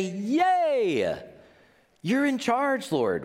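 high, bright cheer of "Yay"; playful hammed-up celebration; mock cheer. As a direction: cheer like a kid at a game. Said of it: "We don't say".

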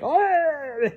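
a high, blank little oh; awkward oh. Retold or imitated. Boyfriend misreading the kiss, awkward high oh. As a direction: sound caught off guard by the kiss.

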